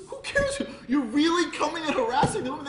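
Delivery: loud, high-pitched outrage; outraged confrontation. Retold or imitated. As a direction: confront them at a high shout. Retold quarrel with shepherds.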